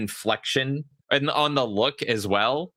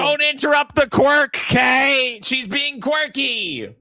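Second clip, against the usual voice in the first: high, loud teasing shout; loud playful shout at Kay; shouting over him; 12 semitones higher, 6 dB louder, slower than usual. Real, not acted. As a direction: yell him down, laughing at him. Shouting over cohost's rant.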